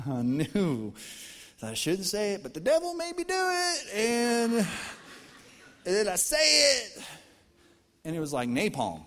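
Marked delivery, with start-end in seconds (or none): 2.4-7.0 mock devilish voice. Pastor confessing sarcastic impulse, hammed-up high devil voice. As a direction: ham up the devil's urging.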